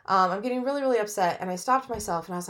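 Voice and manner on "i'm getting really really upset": ordinary delivery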